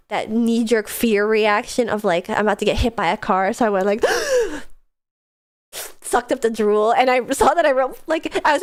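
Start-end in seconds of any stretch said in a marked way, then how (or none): 4.1-4.7 sharp high gasp; startled gasp. Real, not acted. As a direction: jolt awake with a gasp. Waking with a start.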